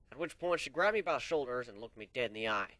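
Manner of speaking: usual speaking voice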